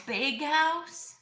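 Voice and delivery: high, slow delighted question; excited echo. Real, not acted. Mom echoes child's idea.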